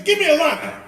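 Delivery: hammed-up thrilled diner, loud and high; excited demand. Acting excited diner tasting food.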